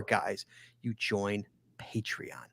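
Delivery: slow whispered aside; slow whisper, like letting them in on a secret. Real, not acted. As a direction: drop to a slow confiding whisper. Aside plug for Patreon.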